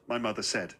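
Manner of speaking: ordinary delivery, nothing marked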